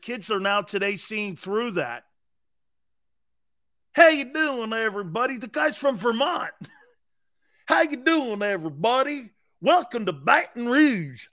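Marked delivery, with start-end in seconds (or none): 3.9-5.4 fake Southern accent. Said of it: "where'd that accent come from". 7.7-11.3 mock Southern accent, pitched up; hammed-up Louisiana welcome, fake accent; Brian Kelly. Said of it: "where'd that accent come from".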